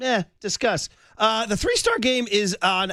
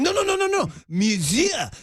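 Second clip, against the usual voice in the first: high rapid-fire scolding, then exaggerated "Monsieur"; hammed-up teacher squealing out a correction; mock French teacher; 9 semitones higher than usual. French teacher correcting a student at his desk, hammed-up exasperation. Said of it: "And she's like".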